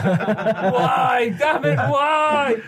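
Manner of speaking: high, drawn-out wail of despair; mock anguish. Fan bemoaning a defender's signing, mock anguished wail. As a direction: cry out in hammed-up disbelief.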